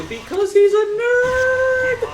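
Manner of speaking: high, slow teasing yell; playful shout. Pretend. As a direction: shout it out to mock your friend. Teasing a co-host on stream.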